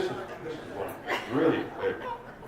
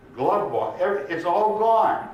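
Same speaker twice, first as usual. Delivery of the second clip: loud, high cry of loss; acted panic climbing to a shout; distressed wail; 10 semitones higher, 8 dB louder than usual. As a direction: wail to the police that everything is lost. Drunk's upset report peaks.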